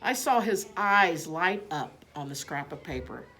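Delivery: plain reading voice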